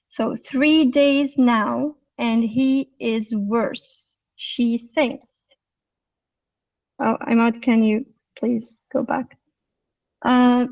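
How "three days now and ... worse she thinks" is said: slow, word-by-word reading, each word separate; normal reading, no linking. Shown as a demonstration. Teacher shows the class the unlinked reading, slow and separate. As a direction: read it out slowly without linking any words. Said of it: "the normal reading".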